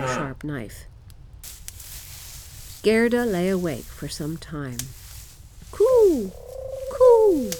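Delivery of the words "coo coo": very high, loud coo; cooing wood pigeons. A narrator's reading. Wood pigeons call to Gerda at night, cooing. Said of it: "said the wood pigeons".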